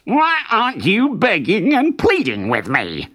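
high, petulant fox whine; whining. A narrator's reading. Fox wanting the rabbit to beg, high whining. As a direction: whine the question like a thwarted villain. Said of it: "whined Brer Fox".

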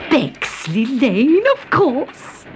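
cried out. A narrator's reading. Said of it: "she cried".

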